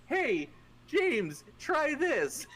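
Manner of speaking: high, chirpy call; eager offer. Acted. Torvik handing James a mushroom, excited high voice.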